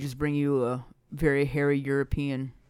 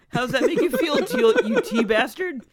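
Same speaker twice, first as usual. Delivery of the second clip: laughing tease; 13 semitones higher, 6 dB louder than usual. Real, not acted. Teasing friend, laughing.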